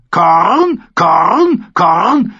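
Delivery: high, loud, rising caws; raven squawk. A narrator's reading. Mormont's raven interrupts the men's food list, squawking. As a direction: break in like an excited raven.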